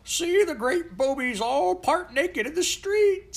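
put-on very high, loud, slow jeering old-time Londoner; a Tudor bystander jeering at the children's clothes, very high; mocking onlooker. A narrator's reading. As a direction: crow it out like a gawping Tudor bystander. Tudor crowd mocks modern clothes.

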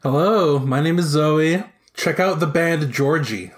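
normal talking voice

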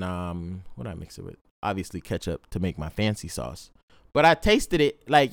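ordinary talk, nothing marked

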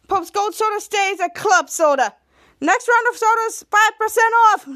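high, loud announcer call; club announcement. Acted. Club host offers the crowd a discount, announcing. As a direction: proclaim it to the whole club.

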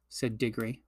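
plain reading voice

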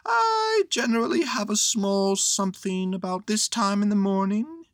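high-pitched hungry little bear; hint hopefully in a high bear voice; Pooh, hinting. Acted.

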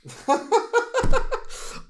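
high burst of laughter; loud laugh. Real, not acted. Laughing at partner's wobbly remark.

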